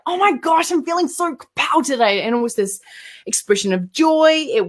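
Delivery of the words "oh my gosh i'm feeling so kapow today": high, delighted gush; lightly acted giddy excitement; excited gushing. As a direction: squeal it with joy. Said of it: "kapow was like".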